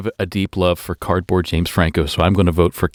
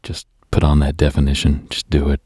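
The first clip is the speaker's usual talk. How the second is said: low-pitched teasing murmur; mock seductive; 7 semitones lower than usual. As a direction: keep it low and unhurried. Continues low teasing slow bit.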